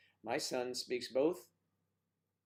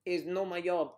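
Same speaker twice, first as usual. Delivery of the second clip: mock Spanish-accented protest, loud and higher; 7 semitones higher, 10 dB louder than usual. Woman joking about her job, loud mock Spanish accent. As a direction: exclaim it with a put-on Spanish accent. Mimics accented English refusal.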